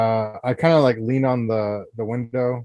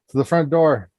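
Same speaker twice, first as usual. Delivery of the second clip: louder, higher call to companions; calling companions to the front door; 6 semitones higher, 6 dB louder than usual. Acted. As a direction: shout across to the party. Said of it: "I call out to my companions".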